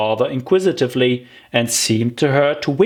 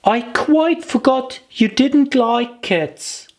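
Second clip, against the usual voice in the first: put-on higher, slow girlish voice; Alice apologising; 13 semitones higher, slower than usual. Alice to the mouse, higher girlish apology. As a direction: gently own up to forgetting.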